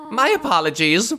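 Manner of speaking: lightly tearful, formal apology; a hint of sniffly upset; tearful game character. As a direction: voice a weepy, proper mother figure. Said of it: "Of course she was crying".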